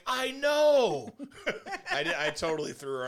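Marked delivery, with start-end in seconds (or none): 0.1-1.1 very high, loud squeal; high loud hammed agreement; mock agreement. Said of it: "I'm like". 1.2-1.9 very high rising laugh; high squeaky laugh. Real, not acted. Laughs after joke.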